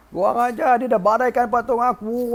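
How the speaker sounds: high-pitched ranting, short of screaming; mock rampage. Husband rages over his broken idol, mock rampage. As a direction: act furious, sparing the throat. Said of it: "Wah, mengamuk orang tu".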